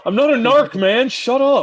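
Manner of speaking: high, loud outburst; loud indignant protest. Real, not acted. Teased comic denies being police, loudly indignant. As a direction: protest the accusation at full pitch.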